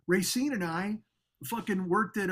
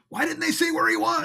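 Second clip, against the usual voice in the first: much higher, louder whiny fan; a fan puzzled at the sign, pitched high and whiny; confused fan; 11 semitones higher, 4 dB louder than usual. Retold or imitated. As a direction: whine it like a lost fan. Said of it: "they'd be like".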